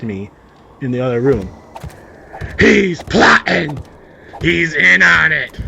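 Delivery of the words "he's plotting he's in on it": high, slow, loud alarm; paranoid accuser. Pretend. Son accusing his brother, high and slow. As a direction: cry out like a conspiracy theorist.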